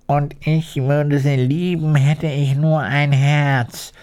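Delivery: long for a heart, sighing; woodman sighing. A narrator's reading. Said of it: "seufzte der eiserne Holzfäller".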